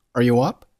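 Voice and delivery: "Are you up" rising at the end; rising question intonation. Shown as a demonstration. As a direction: lift the pitch on "up". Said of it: "并且语调上扬".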